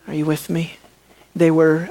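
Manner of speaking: ordinary talk, nothing marked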